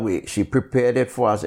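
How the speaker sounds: normal talking voice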